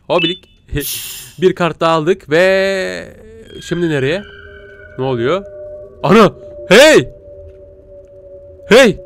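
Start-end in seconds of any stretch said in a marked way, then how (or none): 6.0-9.0 startled yells. Real, not acted. Monster approaches, he yells.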